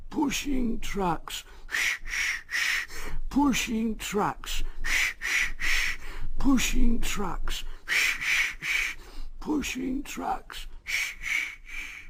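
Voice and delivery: high, slow sulky engine chant with hissing steam; grumbling engine muttering. A narrator's reading. Henry grumbling to himself as he pushes the trucks. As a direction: mutter it over and over like a cross little engine. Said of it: "and muttered to himself".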